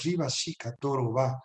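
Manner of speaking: low monotone stream of repeated syllables; droning, chant-like glossolalia; speaking in tongues. Real, not acted. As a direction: pray in tongues, rolling syllables on one low pitch. Orar conmigo en estas lenguas.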